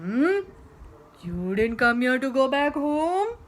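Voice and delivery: loud, drawn-up questioning; incredulous monster. Acted. Monster questioning the stubborn girl, loud incredulity. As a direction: echo it back, climbing in disbelief. Monster echoes her in disbelief.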